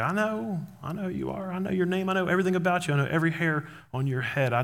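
high, light "yes, I know" voice; lightly acted, high-pitched reassurance. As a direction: cut in gently as if already aware. God answering someone introducing himself.

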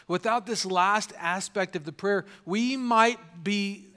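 usual speaking voice

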